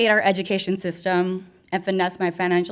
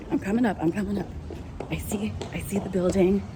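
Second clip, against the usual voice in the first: whisper; 6 dB quieter, faster than usual. Real, not acted. Sneaking toward the venue.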